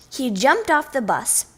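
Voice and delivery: ordinary delivery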